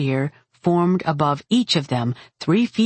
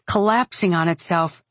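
ordinary delivery, nothing marked

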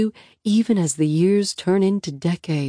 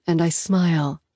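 usual speaking voice

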